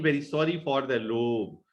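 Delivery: usual speaking voice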